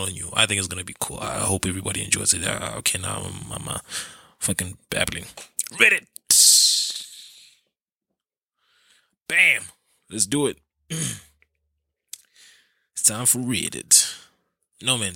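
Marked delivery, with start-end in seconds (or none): 5.7-9.6 high, loud announcer blurt; shouted intro. Real, not acted. Kicking off the Reddit segment with a loud high yell. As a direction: punch it like a segment jingle.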